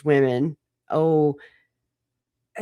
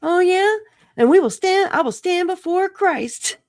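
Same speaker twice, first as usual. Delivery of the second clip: put-on much higher, louder prim old-lady voice; mocking old ladies; 5 dB louder than usual. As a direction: parody smug pious biddies.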